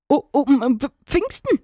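high-pitched, halting, flustered hen; contrite stammer. A narrator's reading. Scolded hen answers the rooster, contrite stammering. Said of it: "stammelte diese ganz zerknirscht".

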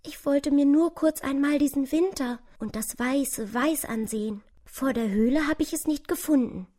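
high, plaintive little mouse voice; kleine, verirrte Maus. Acted. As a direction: play a tired, freezing mouse with a small high voice. (hohes, jammerndes Mäusestimmchen)

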